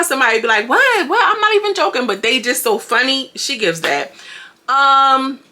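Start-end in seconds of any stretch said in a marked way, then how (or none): 0.7-2.1 high-pitched, loud protest of innocence; imitating an incredulous friend; mock bewilderment. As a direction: squeal it like someone baffled at being laughed at. Mimicking someone unintentionally funny, baffled.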